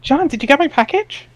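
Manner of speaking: put-on perky girl reading her chat lines up high; Jade's chat voice.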